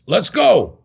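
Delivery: loud, high, punchy retold cheer; eager exclamation. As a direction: burst out with dad's instant enthusiasm. Retold dad's eager two-word reply.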